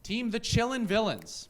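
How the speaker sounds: winner announcement. Acted. Debate host declares the winning team, announcer style.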